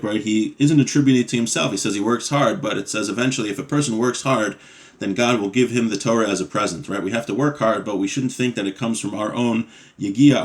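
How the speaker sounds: normal talking voice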